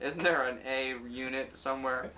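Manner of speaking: loud, exasperated outburst. Retold or imitated. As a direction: vent total frustration. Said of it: "And I'm like".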